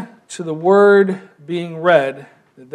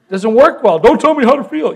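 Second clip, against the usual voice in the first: defiant retort; 5 semitones higher, 5 dB louder than usual. Retold or imitated. Imitating the crier's retort.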